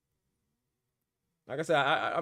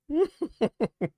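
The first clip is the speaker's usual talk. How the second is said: high mocking laugh; 6 dB louder than usual. Real, not acted. Mocking viewer Jerry.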